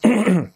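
rough throat clear; real throat clearing. Real, not acted. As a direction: clear the throat mid-lesson. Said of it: "Mira, ahora pasa a mí".

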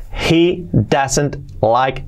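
He doesn't like it stretched out, word by word; very slow model. Shown as a demonstration. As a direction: spell it out slowly for learners. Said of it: "slow model sentence". (muy despacio, palabra por palabra)

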